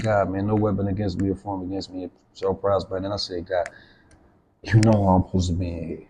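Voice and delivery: quiet, earnest retold prayer; hushed prayer. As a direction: pray it under your breath so nobody hears. Retold silent prayer in cell.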